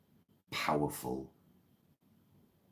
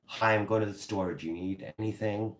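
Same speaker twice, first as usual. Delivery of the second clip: higher-pitched, chirpy Midwestern accent; Midwestern American accent; 5 semitones higher than usual. Shown as a demonstration. Offering to pick something up at the store, Midwestern accent. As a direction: put on a friendly Midwestern errand-runner. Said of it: "is that Midwestern?".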